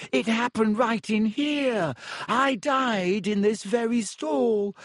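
put-on high, whiny ghost girl voice. A narrator's reading. Myrtle telling her death.

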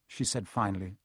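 usual speaking voice

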